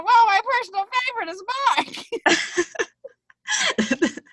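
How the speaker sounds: high, quick puppet voice; squeaky ventriloquist dummy. Pretend. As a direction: answer like the dummy on a hand. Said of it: "I'm your ventriloquist puppet?".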